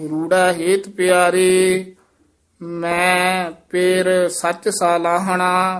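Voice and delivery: measured, sustained Gurbani recitation; scripture recitation. Shown as a demonstration. Teacher reciting verse for students, sustained recitation. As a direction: hold the line endings long and even.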